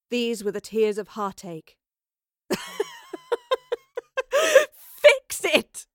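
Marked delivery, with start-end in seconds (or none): 2.5-3.7 high, trilling hysterical giggle; breathless, tremulous high laughter. A narrator's reading. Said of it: "Madeline began to laugh". 4.7-5.9 high, loud gasped exclamation; hysterical gasp. A narrator's reading. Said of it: "she gasped".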